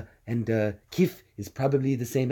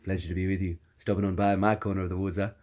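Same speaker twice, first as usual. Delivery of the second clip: lightly voiced cartoon baby host; a hint of Stewie, lower and easy; Stewie Griffin; 5 semitones lower, 5 dB quieter, faster than usual. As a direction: greet them as a genial little character, softening off. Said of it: "I guess, uh, Stewie Griffin".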